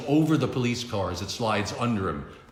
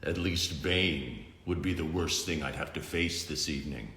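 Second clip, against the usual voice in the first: low, soft, dry hero voice; deadpan gravel tease; Batman's low taunt; 4 semitones lower, 5 dB quieter than usual. Acted. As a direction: hero mocking a villain quietly.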